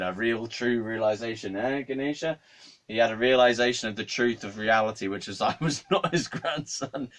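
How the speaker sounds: ordinary talk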